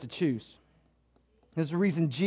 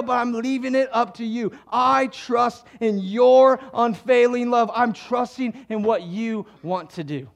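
trusting prayer; 7 semitones higher, 8 dB louder than usual. Acted. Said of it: "He resolved on".

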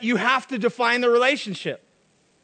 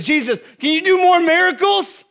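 loud, higher pleading crowd voice; begging crowd; 8 semitones higher, 6 dB louder than usual. Acted. Crowd begging Jesus for more miracles, loud and eager. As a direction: nag him like an eager crowd.

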